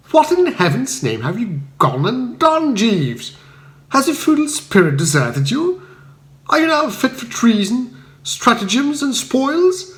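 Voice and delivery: Bertie scolding his valet in dismay; a toff in high-pitched outrage; stern indignation. A narrator's reading. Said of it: "I inquired sternly".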